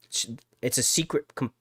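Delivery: ordinary talk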